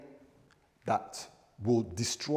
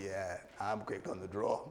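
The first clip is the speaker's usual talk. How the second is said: lightly voiced lower, soft cowboy; challenger's reply; 4 semitones lower, 9 dB quieter than usual. Townsman stepping out to the gunman, lightly acted quiet reply. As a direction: answer the dare low and quiet. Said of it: "And someone steps out and say".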